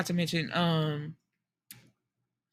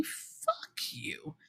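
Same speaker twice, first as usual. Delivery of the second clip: 1 whispered curse; 8 semitones higher, 13 dB quieter than usual. Real, not acted. Whispered aside at the wife.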